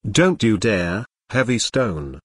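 plain reading voice

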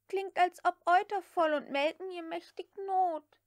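high, eager maid's voice; young maid Prissy. A narrator's reading. Prissy explains the cow to Scarlett in a high voice. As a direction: play the chattering servant girl.

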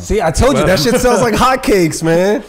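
crowing I told you so; excited. Real, not acted. Friend gloating to the group, loud and excited. Excited exclaim after accent reveal.

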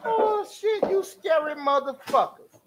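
high, slowed mock-scared reaction; half-laughing mock fright; amused mock fear. Real, not acted. Host reacts to the sketch.

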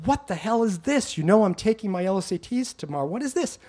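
high-pitched, indignant re-enacted outburst; retold indignant yelling. Child confronting dad over the manuscript, indignant mock yelling. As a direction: act out a worked-up demand for answers. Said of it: "'cause I'm yelling, screaming, getting hysterical".

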